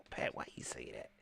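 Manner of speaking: breathy, hushed voice asking himself; whispered self-question. Retold or imitated. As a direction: whisper it like a private doubt. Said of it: "I be like".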